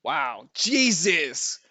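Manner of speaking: slow, loud drawn-out wow; stunned exclamation. Real, not acted. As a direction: react in disbelief at the roll. Reacting to big roll.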